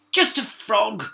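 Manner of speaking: muttered. A narrator's reading. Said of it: "muttered the princess".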